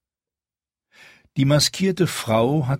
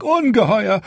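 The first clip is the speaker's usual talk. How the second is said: panicked; 12 semitones higher, 5 dB louder than usual. A narrator's reading. Steward panics over the dragons.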